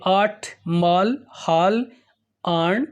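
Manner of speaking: slow word reading. Shown as a demonstration. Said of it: "ये सभी आ की मात्रा वाले हैं".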